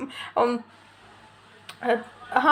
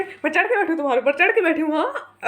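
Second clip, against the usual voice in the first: higher-pitched, quick comeback ending on a rising हाँ; indignant retort; 4 semitones higher than usual. Retold or imitated. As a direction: throw it back as a mock-indignant challenge. Acts out her own angry retort. (चिढ़कर पलटकर जवाब)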